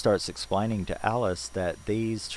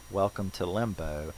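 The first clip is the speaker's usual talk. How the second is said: ordinary delivery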